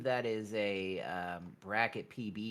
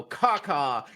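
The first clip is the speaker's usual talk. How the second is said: loud crow caw; 5 semitones higher, 8 dB louder than usual. Acted. Crow call before "feed the birds.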